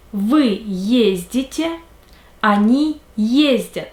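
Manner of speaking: slow drill with each form stressed on its first syllable, "езжу", "ездишь", "ездят"; teacher drilling the stress of "ездить". Shown as a demonstration. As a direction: lean on the first syllable of every form, slowly. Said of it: "The stress is always on the first syllable".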